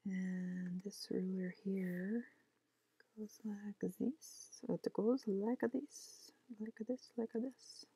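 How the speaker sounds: slow soft whisper while working; whispered demo. Real, not acted. Showing the ruler tool to viewers in a slow whisper. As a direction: breathe the words, slowly, eyes on the screen.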